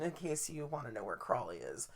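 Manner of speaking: hushed, playful aside; whispered aside. Real, not acted. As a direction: drop to a whisper for the joke. Aside about Crawley.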